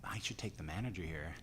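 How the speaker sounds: low, very soft murmur; breaking character. Retold or imitated. Villain dropping his act for a moment, low murmur. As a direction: drop out of the act, low and quiet. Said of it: "he breaks character for a minute".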